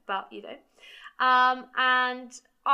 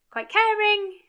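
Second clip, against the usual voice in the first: caring much higher and louder, falling off; stress on caring; 11 semitones higher, 8 dB louder, slower than usual. Real, not acted. Explaining the green personality type, caring stressed high. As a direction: lift and punch caring.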